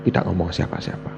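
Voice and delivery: very soft, low reply; meek answer. Retold or imitated. Preacher conceding to God that Abraham told nobody, meek hushed reply. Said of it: "Saya bilang".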